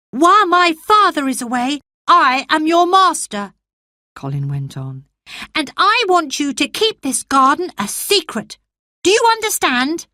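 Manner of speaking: put-on high, lordly boy's orders; a small boy playing master of the house; young master's command. Colin as master.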